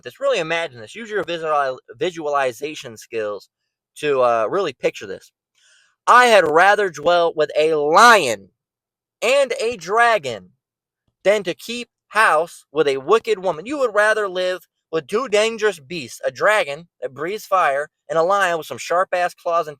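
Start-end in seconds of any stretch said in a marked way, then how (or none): 6.1-13.7 slow, emphatic scripture reading. Acted. Preacher asking listeners to picture the verse, slow scripture reading. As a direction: read the verse out slowly and weightily.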